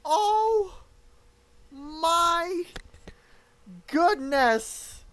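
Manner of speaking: loud, high, drawn-out cry, word by word; stunned. Real, not acted. Commentator reeling from sudden resignation, stunned. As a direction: leave long gaps between stunned words.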